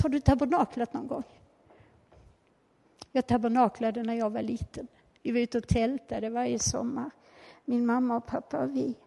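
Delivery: normal talking voice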